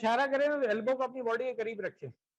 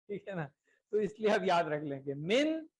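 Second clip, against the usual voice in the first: usual speaking voice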